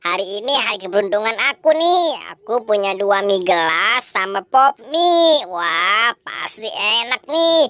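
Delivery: put-on low, gloating then groaning boy; low cartoon boy, hammed-up tummy ache; low greedy boy voice.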